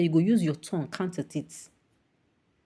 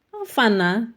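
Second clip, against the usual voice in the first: higher, louder, disbelieving; incredulous; 4 semitones higher, 5 dB louder than usual. Real, not acted. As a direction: demand an answer in disbelief. Incredulous rhetorical question.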